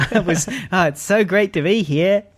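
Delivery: high, giggly, overeager gratitude; gushing thanks. Acted. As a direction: fumble your thanks in a high, starstruck voice. Acting out nervous gratitude at meeting.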